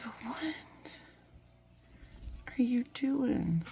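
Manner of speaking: slow, hushed whisper to someone off camera; whispered question. Real, not acted. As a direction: drop to a slow breathy whisper.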